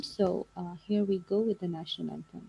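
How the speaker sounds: very soft, faint speech; too far from the mic; barely audible. Real, not acted. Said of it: "your voice is very low".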